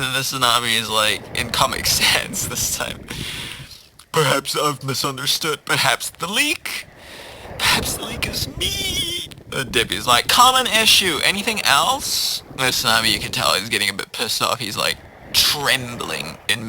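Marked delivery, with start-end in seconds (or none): 4.1-9.3 theatrical voice climbing very high; melodramatic tsunami. Acted. Tsunami realising the leak is itself, rising theatrical lament. 10.3-12.4 lightly voiced high-pitched receptionist; call-centre clerk. Debbie answering the tsunami's call, high-pitched clerk voice.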